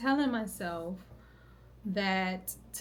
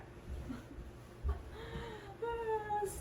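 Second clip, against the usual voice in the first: high laugh; 13 semitones higher, 6 dB quieter than usual. Real, not acted. Realizes she wasn't recording.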